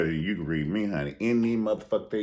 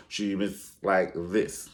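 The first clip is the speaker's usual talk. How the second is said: ordinary talk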